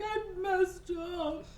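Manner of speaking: very high, hammed-up whine; mock wailing. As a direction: wail in comic despair.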